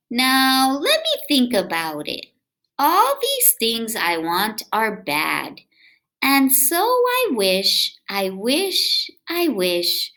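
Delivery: lively, sing-song read-aloud voice; reading to children. A narrator's reading. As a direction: read it to little kids with bounce. Reading picture book aloud.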